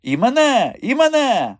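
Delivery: high, loud excited squeal; eager plea. A narrator's reading. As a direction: clamour to be included, high and loud. Madam begs to be photographed too. (збуджений високий вигук)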